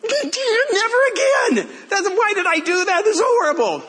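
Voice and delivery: very high mock-terrified squeal; hammed-up, high-pitched panic; retold panicked wail. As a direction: imitate a spooked kid wailing in regret. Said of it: "he came out going".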